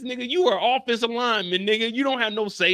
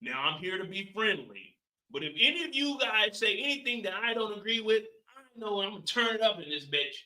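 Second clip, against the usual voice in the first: quiet mock Howard; 8 dB quieter than usual. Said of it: "in here like this".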